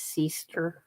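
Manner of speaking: "sister" with a long ee, SEE-ster; my way of saying sister. Shown as a demonstration. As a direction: stretch the first vowel of "sister" to ee. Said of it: "I say my way is".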